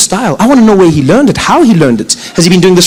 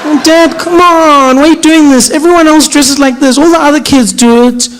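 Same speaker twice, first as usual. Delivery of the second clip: put-on higher, pleading teenage protest; whiny teen daughter; 6 semitones higher than usual. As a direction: whine at dad like a fed-up teen.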